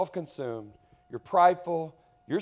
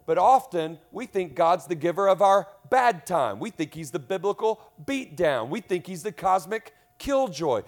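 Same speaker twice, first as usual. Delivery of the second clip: full-force sermon, loud and driving; preaching; 4 semitones higher, 6 dB louder than usual. Acted. Pastor preaching hard to the church, loud and driving.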